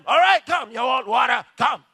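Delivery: loud, high summoning shout; angry calling out. Retold or imitated. Moses calling the people to the rock, shouting. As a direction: shout them over impatiently. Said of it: "Now Moses calls them".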